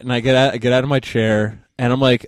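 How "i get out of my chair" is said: ordinary delivery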